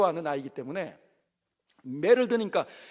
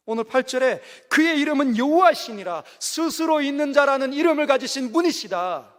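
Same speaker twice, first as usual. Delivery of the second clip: full-force preaching; 4 semitones higher, 4 dB louder than usual. Acted.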